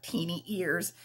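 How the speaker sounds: usual speaking voice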